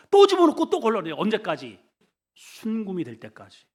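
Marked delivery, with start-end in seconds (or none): 0.1-1.1 much higher and louder second time; building emphasis. Acted. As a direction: drive the repeat home, pushing up. (더 높고 크게 강조하며)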